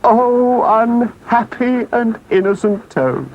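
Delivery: high, pleading, melodramatic Toad; supplication. Acted. Toad begging the engine driver to save him, high-pitched pleading. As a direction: beg for mercy in a high, theatrical wail. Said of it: "raised his paws in supplication".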